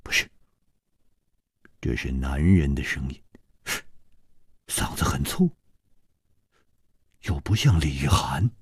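low, hushed, with long pauses; musing near-whisper; pondering. A narrator's reading. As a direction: think aloud softly between pauses. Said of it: "楚留香沉吟着说".

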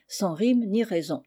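ordinary delivery, nothing marked

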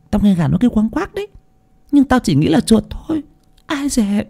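put-on high, quick young servant voice; a frightened maid telling what she heard; nervous servant girl. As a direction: rattle it off, uneasy.

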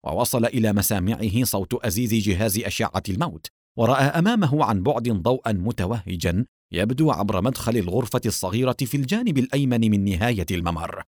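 usual speaking voice

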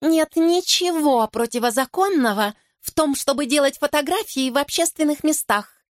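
high-pitched, condescending objection; superior, lecturing tone pitched well up; haughty. A narrator's reading. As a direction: declare it as a snooty know-it-all. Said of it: "высокомерно заявляет одна из подружек блондинки".

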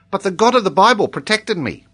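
put-on high, loud, devout child; Tolini. A narrator's reading. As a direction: declare it earnestly as an accented little boy.

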